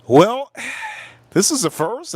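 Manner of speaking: a touch of amused surprise; surprised remark. Retold or imitated. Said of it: "He said".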